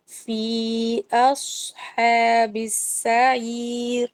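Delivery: slow melodic tajweed chant; measured Quranic recitation. Shown as a demonstration. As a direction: recite it slowly, stretching the vowels. Recites the verse.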